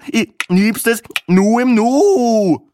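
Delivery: slow, high hammed-up line with "Nu" falling; mocking falling take. Speckhut lands his joke for the table, falling at the end. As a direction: drag it out, drop the end on "Nu" like a punchline.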